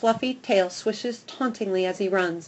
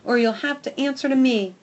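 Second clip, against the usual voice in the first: warning; 5 semitones higher, 4 dB louder than usual. Acted. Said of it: "I bark".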